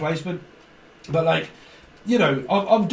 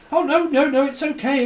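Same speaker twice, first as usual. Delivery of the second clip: higher, sing-song mock reassurance; mock reassuring; 9 semitones higher, 4 dB louder than usual. Imitating the partner who keeps taking over, with mock reassurance. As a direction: play the overeager partner, pitched up.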